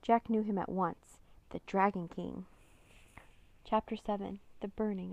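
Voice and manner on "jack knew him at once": usual speaking voice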